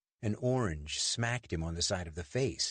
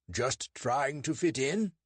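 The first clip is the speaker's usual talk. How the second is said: slow, higher British accent put on; David's put-on British accent, pitched high; 6 semitones higher, slower than usual. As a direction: fake a polite British accent to fool a constable.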